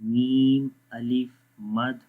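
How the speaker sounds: slow, measured recitation on a level pitch; drawn-out, evenly intoned syllables; Quranic spelling drill. Shown as a demonstration. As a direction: chant each letter, vowel and syllable.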